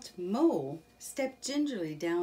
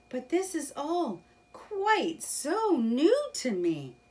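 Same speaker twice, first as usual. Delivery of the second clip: awed Mole; 10 semitones higher, 6 dB louder than usual. Acted.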